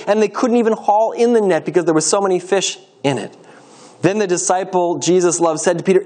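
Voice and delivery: ordinary delivery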